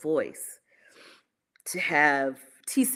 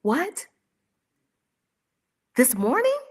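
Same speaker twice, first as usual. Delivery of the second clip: shocked question; 8 semitones higher, 4 dB louder than usual. Retold or imitated. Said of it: "retold shocked reply".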